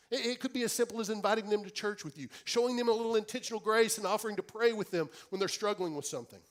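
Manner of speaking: preaching at full force. Acted. Preacher urging the congregation, full-force preaching. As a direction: proclaim it to the whole church.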